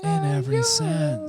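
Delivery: mock sultry voice; a hammed-up seductive purr; sexy voice. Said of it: "Say it in your sexy voice".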